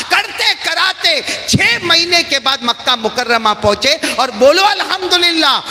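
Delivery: high, loud preacher's climax building to a shout; fiery preaching. Acted. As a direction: preach it at full force, rising to a cry. Preaching climax at full force. (پورے جوش سے بلند آواز میں وعظ)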